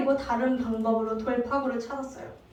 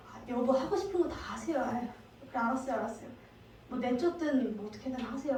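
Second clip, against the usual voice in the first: cowed husband; 9 dB quieter, faster than usual. Retold or imitated. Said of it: "무서우니까".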